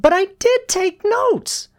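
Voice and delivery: very high, loud schoolgirl protest; shouted protest. A narrator's reading. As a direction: cry out insistently in a girl's voice. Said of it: "Susie shouted".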